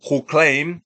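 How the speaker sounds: ordinary delivery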